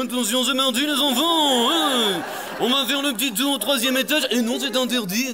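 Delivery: high, loud, gleeful headmaster voice; Dumbledore teasing. Pretend. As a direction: play the prankster headmaster announcing the trick. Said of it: "il se fait un kiff, tu vois, il fait".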